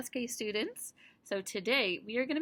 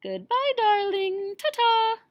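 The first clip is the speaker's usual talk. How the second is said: much higher and louder, a grand lady's farewell; a high, ringing ta-ta from the grand aunt; fancy proper aunt; 12 semitones higher, 8 dB louder than usual. Acted. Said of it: "She's very fancy and proper".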